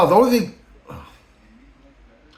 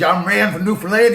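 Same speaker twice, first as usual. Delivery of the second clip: raised-pitch mock voice of the new boss; Rand's voice; 6 semitones higher, 4 dB louder than usual. Retelling the boss's words to viewers, higher mocking voice. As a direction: lampoon the boss, pitched up and louder. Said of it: "I'm just filling in voice".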